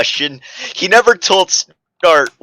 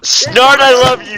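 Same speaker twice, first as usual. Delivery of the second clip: higher, louder, insistent; adamant declaration; 10 semitones higher, 5 dB louder than usual. Acted. Character confessing love to crush, adamant. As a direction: declare it fiercely. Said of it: "Sharp said adamantly".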